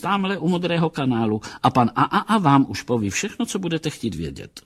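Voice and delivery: high-pitched woman's character voice; brusque housewife. Acted. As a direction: send him off in a high, busy voice. Housewife brusquely redirects the captain. (vysoký hlas odbývající hospodyně)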